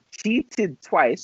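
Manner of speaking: ordinary talk, nothing marked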